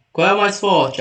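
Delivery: ordinary talk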